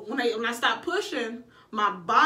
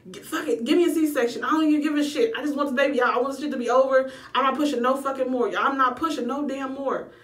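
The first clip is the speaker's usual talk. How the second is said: retold frantic demand; 3 semitones higher, 4 dB louder than usual. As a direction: insist at full volume. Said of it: "I was like".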